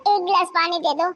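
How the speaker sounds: put-on high, weak old voice between coughs; feeble old woman. Coughing mother-in-law begs her daughter-in-law for water, feeble. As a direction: croak out the plea for water.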